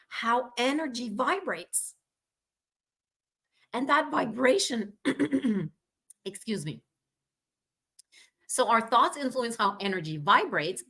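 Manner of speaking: normal talking voice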